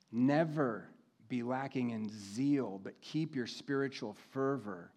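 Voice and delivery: slow, deliberate scripture reading; measured and weighty, with long pauses. Real, not acted. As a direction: read each instruction slowly, pausing to let it land. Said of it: "I'm gonna read this slowly".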